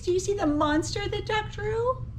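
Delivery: usual speaking voice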